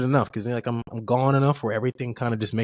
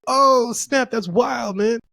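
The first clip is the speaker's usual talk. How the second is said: high, loud burst of amazement; amazed reaction; 10 semitones higher, 4 dB louder, much slower than usual. Retold or imitated. Retelling his reaction to war footage, amazed. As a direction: exclaim it high, reliving the surprise.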